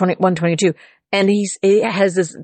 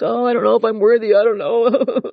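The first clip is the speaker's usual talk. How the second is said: higher, hurried self-doubting whimper, put on; mock whining; 4 semitones higher than usual. Preacher mimicking someone doubting their worth, mock whining. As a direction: imitate someone fretting over being unworthy. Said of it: "Not kowtowing, not meowing".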